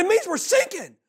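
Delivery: high, mock alarm; panicked captain. Captain telling his student, high mock panic. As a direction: squeal the bad news. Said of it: "He said".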